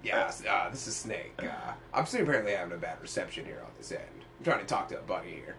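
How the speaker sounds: Snake impression. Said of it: "when I can barely do Snake".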